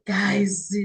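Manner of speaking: breathy whispered guys. Real, not acted. Vlogger whispers to the audience after a long pause. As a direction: lean in and whisper to the viewers.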